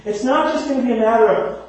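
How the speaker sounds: ordinary delivery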